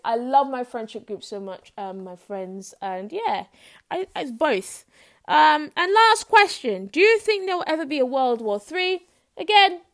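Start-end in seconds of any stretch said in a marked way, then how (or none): 5.3-9.1 dramatic announcement. Acted. Dramatic last question announcement.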